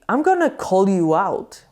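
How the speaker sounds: ordinary talk, nothing marked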